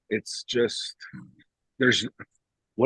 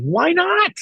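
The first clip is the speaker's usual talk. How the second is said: high, louder indignant retort; mock indignant; 4 dB louder than usual. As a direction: fire it back in innocent defiance. Retold defiant reply.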